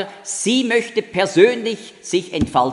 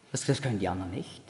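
much softer and lower, quick; hushed aside; 10 semitones lower, 12 dB quieter, faster than usual. Acted. Someone with a superiority complex on the others, hushed. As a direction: drop it to a low, quick murmur.

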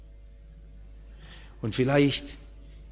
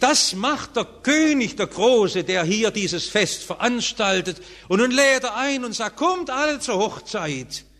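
preaching at full force; 7 semitones higher than usual. Acted. Preacher telling the wedding parable, fervent preaching. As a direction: lift the voice to tell of the king.

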